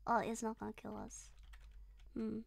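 ordinary talk

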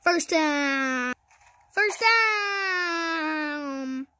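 stadium-style call, final vowel held; drawn-out announcer call. Real, not acted. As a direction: stretch the last word into a long call. Announcing a gain like a commentator.

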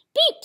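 high, loud little beep; truck beep. A narrator's reading. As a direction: pip it like a cheerful toy horn. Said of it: "said Blue".

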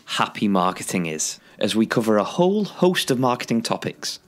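loud, upbeat host announcement; podcast intro read. Acted. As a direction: sell the show like a radio host. Podcast intro read over music.

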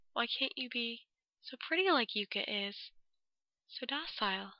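taunting Yuta. Acted. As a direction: hiss the envy quietly.

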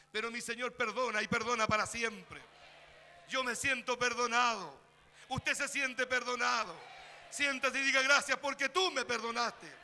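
loud, fervent preaching that lifts the crowd; preaching at full force. Acted. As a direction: proclaim it to the congregation. Predicación a plena fuerza. (predicación fervorosa y fuerte)

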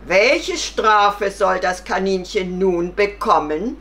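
loud, commanding question; lion passing judgement. Acted. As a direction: demand a verdict as king of beasts. Stern lion asks for the punishment. (lauter, gebieterischer Löwe)

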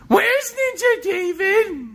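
high, slow, sing-song call; silly high character voice. Acted. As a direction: stretch it out like a cartoon. Character voice calling out.

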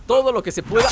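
usual speaking voice

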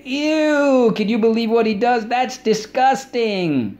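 high, loud mock revulsion; hammed-up grossed-out gossip; disgusted squeal. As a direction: squeal it in exaggerated disgust, dragging out the end. Acts out someone being disgusted.

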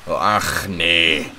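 Scottish ach, nee, put-on; a jokey Scottish accent. Shown as a demonstration. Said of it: "any kind of northern accent".

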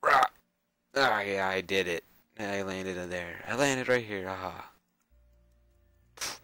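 ordinary talk, nothing marked

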